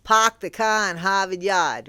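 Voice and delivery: playful amateur Boston accent; hammy stock-phrase Boston accent. Shown as a demonstration. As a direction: drop the R's like a Bostonian. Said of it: "I'm not great with accents".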